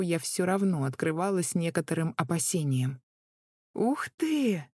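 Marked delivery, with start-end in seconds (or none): 3.7-4.6 high amazed wow; surprised gasp. A narrator's reading. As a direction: blurt it out in surprise. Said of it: "невольно вырвалось у меня".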